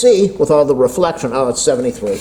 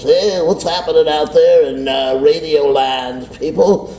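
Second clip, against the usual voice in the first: DJ voice; 2 semitones higher than usual. Acted. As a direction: greet Radio Land like a DJ. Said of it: "You notice when you talk like a D J".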